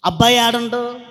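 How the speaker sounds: normal talking voice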